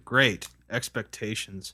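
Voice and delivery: slow, drawn-out sarcastic title. Acted. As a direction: stretch GREAT with heavy sarcasm. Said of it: "You can, like, be sarcastic".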